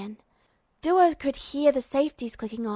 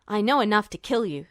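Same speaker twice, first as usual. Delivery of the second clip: snapped threat; 3 semitones lower, faster than usual. Acted. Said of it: "Duo snapped".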